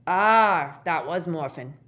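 offhand, loud first syllable then dropping; unconcerned reply; indifferent. Acted. Gaunt answering the official, indifferently. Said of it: "said the man indifferently".

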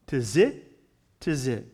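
"Tizit" sounded out, rising, then repeated falling; phonetic pronunciation. Shown as a demonstration. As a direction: demonstrate "tizit" carefully as tih-ZIT, with a pause between. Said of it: "phonetic pronunciation in the Hebrew lexicon".

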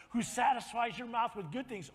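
usual speaking voice

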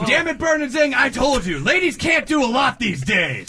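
loud, high scolding boss; angry boss yelling. Acted. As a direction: chew them out as the boss. Boss scolds the lawyers again.

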